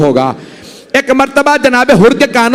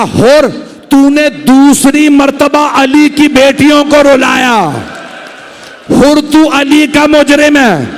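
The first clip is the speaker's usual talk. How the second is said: anguished self-reproach; 5 semitones higher than usual. Acted. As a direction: shout at yourself in grief. Hurr beating his head, self-reproach. (खुद को कोसती ऊँची, ज़ोरदार पुकार)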